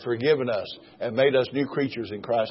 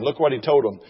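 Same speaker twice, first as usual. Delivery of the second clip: ordinary talk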